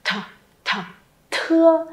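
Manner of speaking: "th" breathed out hard, then "thưa"; aspirated initial drill. Shown as a demonstration. Teacher drilling an aspirated consonant for students, breathy and clear. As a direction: push air on each "th". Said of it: "Tiếp theo âm th".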